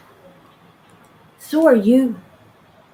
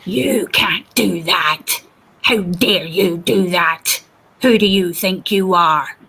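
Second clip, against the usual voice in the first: mocking bully; 3 semitones lower, slower than usual. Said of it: "they're actually in your face".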